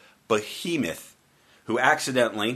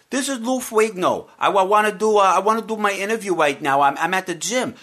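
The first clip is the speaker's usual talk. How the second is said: high, loud put-on voice of a gym caller; imitating Lou Ferrigno; 8 semitones higher, 5 dB louder than usual. Host retells Ferrigno calling him from the gym. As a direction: play the eager celebrity on the phone. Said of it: "when Lou Ferrigno said".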